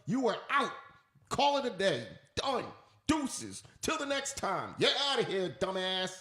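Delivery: a hint of a scornful boss; dismissive boss. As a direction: wave the employee off as a boss would. Acted boss suspending an employee.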